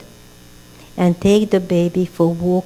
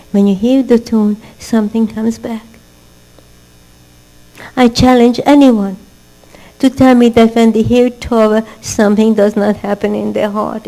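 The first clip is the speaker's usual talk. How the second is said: ordinary talk, nothing marked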